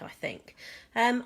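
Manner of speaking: ordinary delivery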